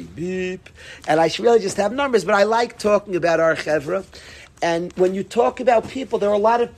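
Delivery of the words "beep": soft, held mock beep; imitated phone beep. Speaker mimics a phone menu's tone, imitated beep. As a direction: beep like an answering machine.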